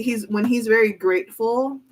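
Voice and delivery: ordinary delivery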